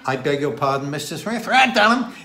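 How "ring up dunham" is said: very loud, high Dorset holler; broad, barely intelligible Dorset shout; Dorset dialect. Retold or imitated. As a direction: imitate an old villager calling out in broad Dorset. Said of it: "Translated to something like".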